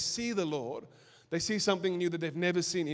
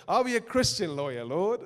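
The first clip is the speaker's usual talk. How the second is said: laughing; 3 semitones higher than usual. Retold or imitated. Mocking his own naive prayer.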